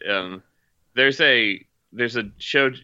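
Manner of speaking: normal talking voice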